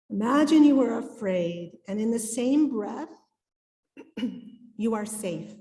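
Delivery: ordinary delivery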